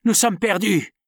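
captain crying out to the crew; cry of despair. Acted. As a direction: blurt out the bad news. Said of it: "s'écria Shandon".

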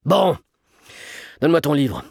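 irritated, forceful grumble; gruffly vexed command; sepulchral growl. A narrator's reading. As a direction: growl the order, annoyed and solemn. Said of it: "gronda Gontran Pellepoire d'une voix sépulcrale".

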